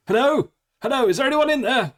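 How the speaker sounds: high, loud anxious soldier calling out. Acted. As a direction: call out high and loud, unsure who's there. GM voices soldier calling from back.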